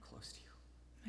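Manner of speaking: 2 slow, barely voiced whisper; 3 whisper it as in a dream; 4 whispering to his love Corinne; 1 intimate whisper.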